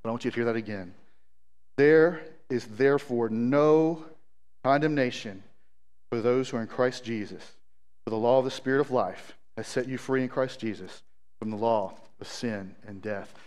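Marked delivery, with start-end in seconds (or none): 1.8-13.3 low, soft, unhurried scripture reading; encouraging scripture reading. Real, not acted. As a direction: reassure them with the verse, pitched low. Say that again just as encouragement.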